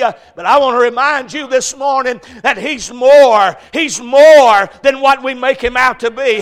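loud, high, rising preaching climb; full-force preaching. Acted. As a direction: build the sermon toward its peak.

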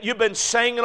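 ordinary talk, nothing marked

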